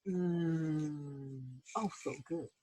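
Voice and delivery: soft low mmm of pleasure; savoring. Acted. Ruth tasting her warm cookie, soft savoring moan. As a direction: melt into the taste, quiet.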